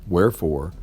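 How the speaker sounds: plain reading voice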